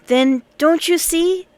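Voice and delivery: put-on a boy thrilled by his own idea; lightly voiced boy leader, excited; eager boy. A narrator's reading. Oswald explains eagerly.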